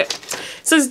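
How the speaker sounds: ordinary talk, nothing marked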